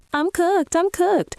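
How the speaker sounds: high mock-panicked squeak; imitating her panic. Commentator mimicking the storyteller, high mock panic. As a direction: parody her hiding-under-the-seat panic. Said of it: "The way she tells this story".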